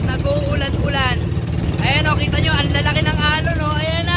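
loud, excited shout over the waves. Real, not acted. Showing viewers the big waves, shouting over wind. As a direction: call out loudly over the storm.

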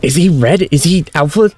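high, frantic questions; panicked, high-pitched disbelief; alarmed. Real, not acted. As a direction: blurt it out in sudden alarm. Alarm during the attack.

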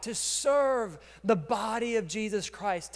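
normal talking voice

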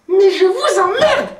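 high, slow, broken voice; rusty voice. Acted. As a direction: croak it out like a ruined voice changer. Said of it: "je vous avais dit que j'avais une voix pourrie".

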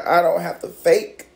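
normal talking voice

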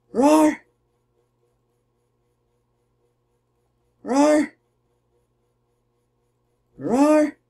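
hammed-up battle roar; mock roar. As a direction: roar like a cartoon beast. Voicing a fighter's battle cry.

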